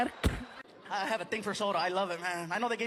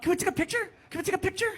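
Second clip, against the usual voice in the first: eager fan voice, pitched up; excited fans; 9 semitones higher, much faster than usual. Retold or imitated. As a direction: play the fans begging for a photo. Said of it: "people kept walking up".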